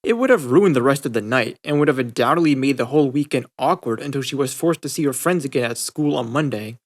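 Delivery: usual speaking voice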